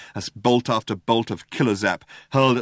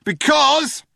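high, loud holler; shouting cop; 7 dB louder than usual. A narrator's reading. Cop answering the fugitive's question, shouting. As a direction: shout it out across a distance. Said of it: "shouted the cop".

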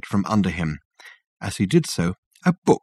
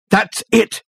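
sudden realisation; 12 semitones higher, 3 dB louder than usual. Acted.

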